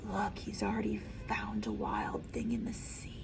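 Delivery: put-on low whispered aside to a child. A narrator's reading. Reader whispers to the listening child. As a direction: point it out in a hushed voice.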